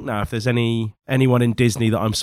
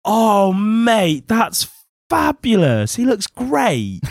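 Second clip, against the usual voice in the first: delighted; 9 semitones higher, 5 dB louder, much slower than usual. Real, not acted. Delighted at the mugshot.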